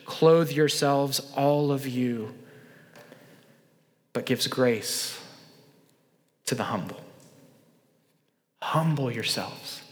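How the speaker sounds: slow, soft, low reading; solemn scripture reading. Acted. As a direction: read the verse reverently and slowly.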